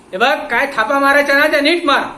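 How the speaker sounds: loud, high angry shout. Acted. Inspector shouting at the watchman to lie better. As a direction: yell at the liar. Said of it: "Pandit साहेब ओरडले".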